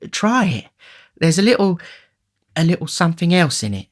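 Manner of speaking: high, hesitant lady's voice; coaxing lady. A narrator's reading. Matron urges the beadle to taste, coaxing. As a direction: coax him in a high voice, with pauses.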